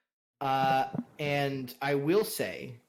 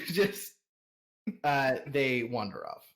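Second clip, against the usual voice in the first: normal talking voice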